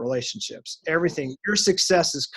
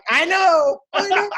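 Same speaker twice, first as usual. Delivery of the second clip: very high, louder exaggerated wail; mock commiseration; 14 semitones higher, 5 dB louder, slower than usual. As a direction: wail back in hammed-up sympathy. Teller wails back at crying driver.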